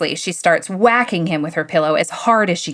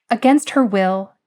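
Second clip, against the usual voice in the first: plain reading voice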